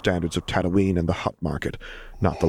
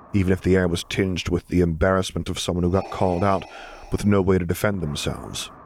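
ordinary delivery, nothing marked

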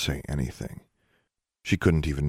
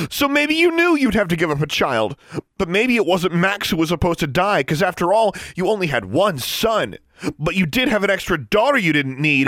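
very high, loud, shrill tirade; rising shrilly; 7 dB louder than usual. A narrator's reading. Daughter accusing her father of sacrificing her, shrill. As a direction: let the accusation climb to a shrill pitch. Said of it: "her voice rising shrilly".

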